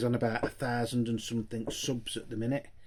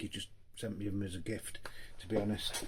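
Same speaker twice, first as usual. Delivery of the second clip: whispered aside; 3 semitones lower, 7 dB quieter than usual. Real, not acted. Aside about a gift.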